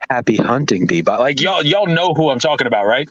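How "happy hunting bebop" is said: low, slow sultry radio DJ. Retold or imitated. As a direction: purr it like a late night radio host. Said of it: "the most, like, sultry radio voice ever".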